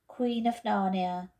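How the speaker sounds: usual speaking voice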